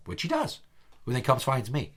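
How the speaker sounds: normal talking voice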